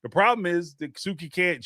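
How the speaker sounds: normal talking voice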